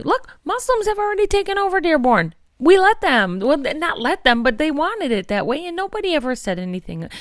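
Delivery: hammed-up imitation of a bigoted campaigner; mocking Anthony Hudson. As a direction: parody a smug candidate, pushing it high and quick. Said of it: "he came to Dearborn".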